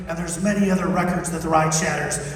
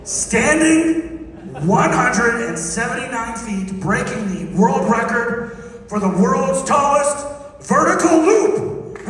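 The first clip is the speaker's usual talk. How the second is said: slow, building, louder announcement; building hype; 4 semitones higher, 4 dB louder than usual. Acted. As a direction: build it up to a big reveal. Repeats record building with hype.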